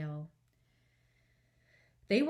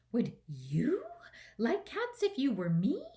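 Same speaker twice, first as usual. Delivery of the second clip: whispered start, then a high indignant squeak; a furious little mouse, shrill; shrill and passionate; 4 semitones higher, 3 dB louder than usual. A narrator's reading. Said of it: "in a shrill and passionate voice".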